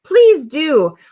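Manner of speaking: high, bright eager yes. Retold or imitated. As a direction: gush like a keen customer. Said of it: "ninety percent of them are gonna be".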